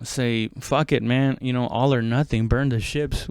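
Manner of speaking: ordinary talk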